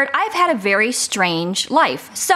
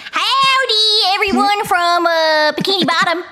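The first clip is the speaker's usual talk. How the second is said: Sandy; 7 semitones higher, slower than usual. Shown as a demonstration. Guest greets listeners as Sandy on request, high cartoon Texan. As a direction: stretch out a high howdy, then settle as you go. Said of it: "can you say something as Sandy".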